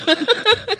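short high laugh; a quick laugh. Real, not acted. Laughs at kids' languages.

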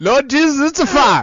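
high, loud hammed-up panic; mock panic. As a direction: shriek it like the car's on fire.

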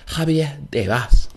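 carefully Spanish-sounding Javier Tebas; Spanish try at a name. Shown as a demonstration. Pundit retrying the La Liga boss's name in Spanish. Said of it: "I don't know if that's how they say it in Spain".

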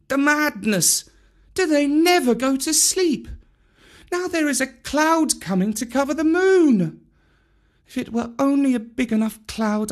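put-on a tired boy thinking aloud; Mowgli to himself. Mowgli muttering to himself among the monkeys, boyish and weary. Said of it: "he said to himself".

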